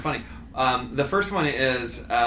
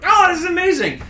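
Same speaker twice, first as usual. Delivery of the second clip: very high, loud gush of amazement; freaking out; 8 dB louder than usual. Retold or imitated. Storm chaser reliving filming dramatic clouds, freaking out. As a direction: reenact an over-the-top excited freak-out. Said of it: "I'm, like, freaking out, going".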